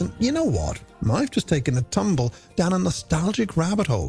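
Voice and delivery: usual speaking voice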